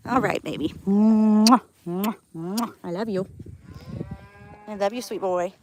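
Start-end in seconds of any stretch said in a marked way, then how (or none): 0.9-2.7 loud smacking air kisses; kiss sounds. Real, not acted. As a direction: blow kisses to a pet. Kissing sounds to pet.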